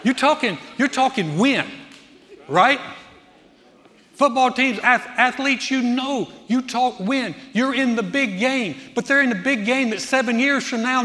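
high, loud, emphatic sermon delivery; fired-up preaching. Acted. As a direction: preach it with rising force. Preaches at full force.

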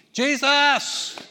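high, loud desperate call; beggar yelling. Retold or imitated. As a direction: call out to Jesus across the crowd. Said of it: "He began to yell even louder".

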